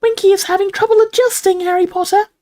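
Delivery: very high elf squeak; confidential squeak. A narrator's reading. Dobby confides in Harry, confidential squeak. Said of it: "squeaked Dobby confidentially".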